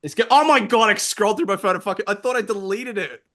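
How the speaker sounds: high, loud, real embarrassed panic; flustered outburst. Real, not acted. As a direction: blurt it out, breaking into helpless laughter. Flustered discovering undeleted phone content.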